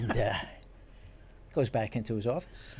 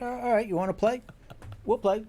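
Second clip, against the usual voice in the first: higher, defiant you-wanna-play dare; mock challenge; 11 semitones higher, 4 dB louder than usual. Pranked detective daring his boss, defiant. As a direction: throw down the gauntlet, game on. Pranked cop vows revenge.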